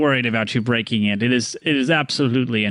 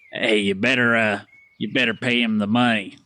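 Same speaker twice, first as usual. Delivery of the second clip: lightly lower, muttered threat; gruff henchman; 3 semitones lower than usual. Acted. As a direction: lean in and lean on them. Disguised accomplice threatens.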